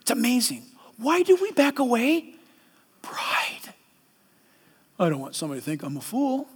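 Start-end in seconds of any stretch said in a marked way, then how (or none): 3.0-3.7 a hushed one-word whisper. Real, not acted. Preacher whispering the answer to his church. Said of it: "one-word answer". 5.0-6.5 low, quick mumbled excuse; quick soft excuse. Pretend. Preacher voicing a proud believer, low and quick.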